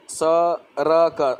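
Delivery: सड़क sounded out very slowly with plain र for ड़, "sa, ra, ka"; wrong pronunciation. Shown as a demonstration. Teacher showing the wrong way, सड़क slowly as "sa-ra-ka". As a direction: break सड़क into syllables, mispronouncing ड़ as a tapped r. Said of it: "सड़क बोलूँ तो ये गलत हो गया".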